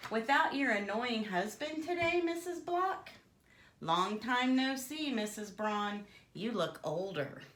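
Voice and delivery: a lightly voiced snide pig, jumping high on the names; rude pig. Bertie insulting his neighbours, a hint of snide pig. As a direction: sneer at each neighbour by name.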